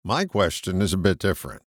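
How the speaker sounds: ordinary talk, nothing marked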